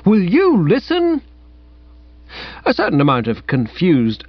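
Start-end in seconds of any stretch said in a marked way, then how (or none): 0.1-1.2 high, pushed appeal; high exasperated call; acted yell. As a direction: demand they listen. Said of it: "I yelled".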